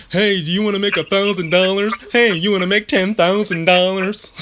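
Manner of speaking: loud, high-pitched hype-man sales pitch; mock Instagram hustler, pushing it hard; fake guru pitch. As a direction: imitate an overexcited online money guru. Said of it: "fake gurus out there".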